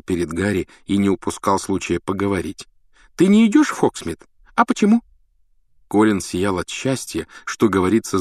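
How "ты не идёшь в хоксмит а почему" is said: high, bright excited questions; eager young fan. A narrator's reading. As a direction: gush at your idol. Colin, adoring fan.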